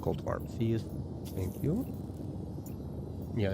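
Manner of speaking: ordinary talk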